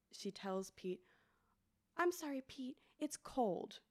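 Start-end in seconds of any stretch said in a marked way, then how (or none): 2.0-3.8 put-on toddler voice. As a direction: play a small child warning gently. Toddler quoted warning doll.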